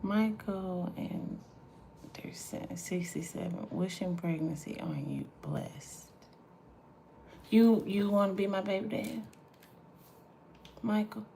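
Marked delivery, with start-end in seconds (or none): 0.0-6.1 hushed reading of the message, breath only; whisper. Real, not acted. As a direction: read the comment out in a whisper. Reads a comment aloud.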